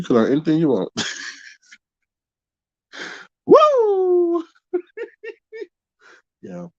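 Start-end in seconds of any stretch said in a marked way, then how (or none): 3.5-4.4 1 laughing whoop. Real, not acted.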